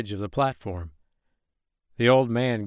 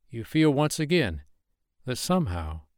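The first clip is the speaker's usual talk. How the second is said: usual speaking voice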